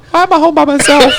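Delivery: put-on very high, wailing little-kid voice; mock childish wail through laughter; five-year-old voice. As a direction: imitate a bewildered small child left alone. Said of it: "I just remember being, like, five going".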